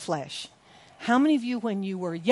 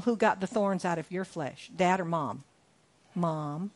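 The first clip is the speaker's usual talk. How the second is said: ordinary talk, nothing marked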